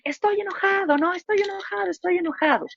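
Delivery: high, emphatic voicing of an upset child; voicing a child naming his anger; child saying he's angry. Shown as a demonstration. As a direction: model the words for an angry kid, pitched well up. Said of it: "puedes decir".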